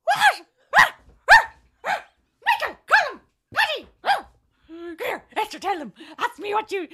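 high, loud incredulous yelling; hammed-up barmen jeering loudly; mocking barmen shout. Barmen mocking the barmaid's order, loud high yelling. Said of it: "And of course they went".

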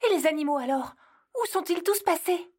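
high worried where did they go; anxious questions. Acted. Elf anxiously asks Santa where the animals went, high and worried. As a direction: ask with rising unease.

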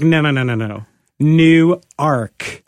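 quick no-no-no, then New Ark split in two; retold correction. Delaware locals correcting a visitor, insistent New Ark in two words. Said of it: "and they're like".

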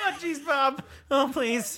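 high, pleading cartoon voice; Morty. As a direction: plead squeakily, laughter underneath. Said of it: "[name]'s now Morty".

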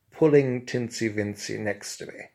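ordinary delivery, nothing marked

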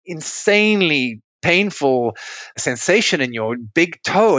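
normal talking voice

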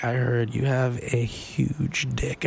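low, slow and soft sultry line; sexy. Retold or imitated. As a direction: purr it out slow and seductive. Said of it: "You're saying that really sexy".

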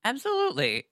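ordinary delivery

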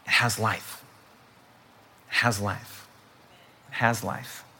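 very soft, low, reverent repetition; hushed emphasis. Real, not acted. Hushed repeated line for effect.

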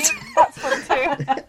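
laughing. Real, not acted. Laughing at the joke.

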